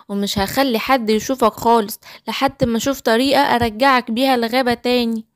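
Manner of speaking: usual speaking voice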